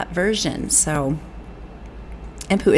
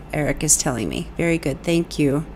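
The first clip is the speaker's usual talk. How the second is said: ordinary talk, nothing marked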